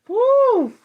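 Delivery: real high-pitched swooning whoop; swooning woo. Real, not acted. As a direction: let out a high appreciative "woo". Swooning over New Orleans accents.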